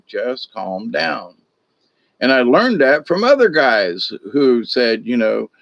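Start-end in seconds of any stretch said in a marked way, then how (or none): 0.1-1.4 softer and slow, steady soothing; calming. Retold or imitated. Carnival worker stepping between drunks, slow soft calming. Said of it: "you had to step in and go".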